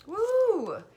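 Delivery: high, loud whoop of joy; excited whoop. Acted. Excited whoop voicing the cards' joy. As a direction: cheer like the cards are thrilled.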